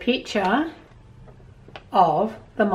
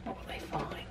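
whispered, teasing question; suspenseful whisper; 6 semitones lower, 19 dB quieter than usual. Acted. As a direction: hush it to build suspense. Suspense before page turn.

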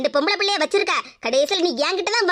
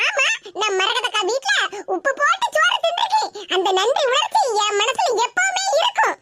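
higher, needling retort; quarrelsome woman; 3 semitones higher than usual. Acted. Geetha snaps back at her accuser in the quarrel, higher and needling. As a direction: bicker back with a wounded, sly edge.

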